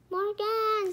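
high-pitched anxious call. A narrator's reading. Annie calling to the frozen Morgan, calling out. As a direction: call the name across a room.

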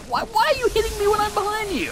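high-pitched, indignant outcry; alarmed protest. Real, not acted. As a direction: wail in disbelief at an unfair hit. Protesting at being hit by enemy.

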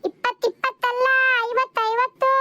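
high-pitched, insistent calling out; exasperated correction. Acted. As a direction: repeat the number high so she hears. Corrects hard-of-hearing customer by calling.

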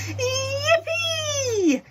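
very high, loud whoop of joy; joyful shout. A narrator's reading. Grandpa Joe dancing with Charlie, very high joyful whoop. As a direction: whoop like a delighted old man. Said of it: "shouted Grandpa Joe".

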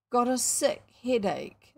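slow, lightly voiced ailing boy; faint voice. A narrator's reading. William telling his mother he's ill, slow and ailing. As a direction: drag it out, playing poorly. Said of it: "in a faint voice".

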